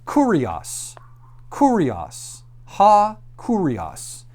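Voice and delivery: "kurios" said KOO-ree-ahs, falling; "kurios" as a separate, falling citation word; Greek vocabulary demonstration. Shown as a demonstration. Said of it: "Go to our next word".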